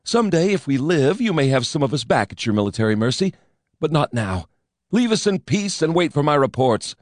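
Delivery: defiant outburst. A narrator's reading. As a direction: drive the admiral away. Defiant speech to the admiral.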